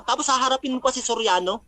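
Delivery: ordinary delivery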